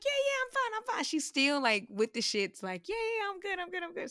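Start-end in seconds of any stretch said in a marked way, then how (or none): 0.0-1.0 very high mock reassurance; squeaky-high protest that all is well; insisting friend. Retelling friend's answer before kayaking, very high insistence. 2.9-4.1 high-pitched mock reassurance; insisting friend. Imitating friend still insisting, high-pitched reassurance.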